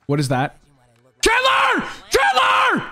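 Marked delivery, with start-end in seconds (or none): 1.2-2.9 shouting a name. Real, not acted. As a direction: scream his name across the yard. Calling teammate in danger.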